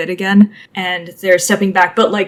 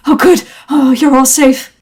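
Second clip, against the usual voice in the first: high, louder gush of relief; breathless relief; 6 semitones higher, 5 dB louder than usual. Acted. Zachary runs up to the three friends, high relieved outburst. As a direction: burst out relieved.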